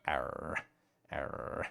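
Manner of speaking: low, quiet "Arr", the second one fading to a whisper; soft pirate growl. Shown as a demonstration. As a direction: growl "Arr" low, then let it die out breathless. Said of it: "I'd rather go".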